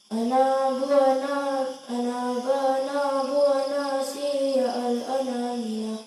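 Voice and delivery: ana chanted with the na held long; recited slowly. Shown as a demonstration. Teacher recites the verse for students, slow chant. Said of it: "Nanya dibaca panjang".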